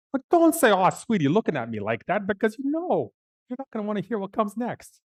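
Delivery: high-pitched mock warning; exasperated, high aside; indignant protest. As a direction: object to being pitied, pitch way up. Indignant aside at receptionist's pity.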